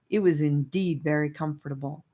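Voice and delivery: ordinary delivery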